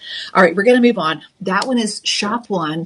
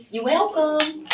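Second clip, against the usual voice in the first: pitched up high, teasing; playful high singsong; 8 semitones higher, 3 dB quieter, slower than usual. Pretend. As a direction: sing it back sweetly, much higher. Replying to Tyler's thanks.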